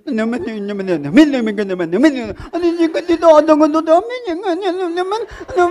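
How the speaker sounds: stuck on the words, panicking; imitating a nasal stuttering boy; nasal stammering kid. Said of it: "amiguito gangoso".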